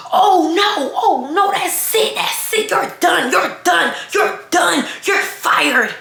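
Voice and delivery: high-pitched, hammed-up outrage; mock a panicking coworker firing someone, very high; frantic coworker impression. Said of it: "little hair came from the back talking about some".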